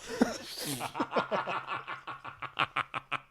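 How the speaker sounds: soft unvoiced laughter; breathy laugh. Real, not acted. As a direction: laugh through it quietly. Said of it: "couldn't even get through that sentence without laughing".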